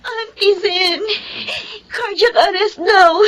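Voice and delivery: crying while telling the news; tearful. Acted. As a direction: speak through tears, voice catching. Daughter at hospital, crying.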